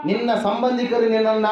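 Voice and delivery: normal talking voice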